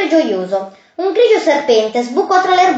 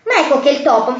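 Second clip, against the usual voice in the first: plain reading voice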